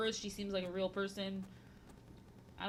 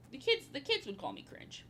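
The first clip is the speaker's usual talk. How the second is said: ordinary talk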